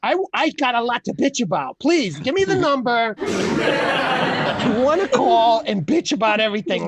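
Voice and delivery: laughing mock request. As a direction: giggle through a joke plea. Mock calling the bitcher.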